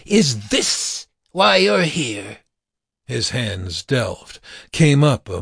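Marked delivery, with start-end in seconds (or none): 0.1-2.4 slow, high-pitched challenge; taunting cry. A narrator's reading. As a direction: cry it out slowly at the enemy. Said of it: "as Roger cried out".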